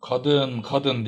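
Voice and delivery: loud warning call; thundering. A narrator's reading. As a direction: roar at her to stop. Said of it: "diye gürledi".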